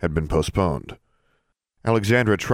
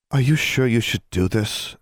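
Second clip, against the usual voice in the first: worried pleading; 5 semitones higher than usual. A narrator's reading. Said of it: "for perhaps the fifth time".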